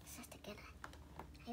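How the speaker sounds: hushed whisper to the toy sister. Pretend. Child whispering to her toy sister to get in. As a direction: breathe the words, no voice.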